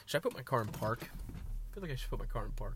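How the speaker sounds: usual speaking voice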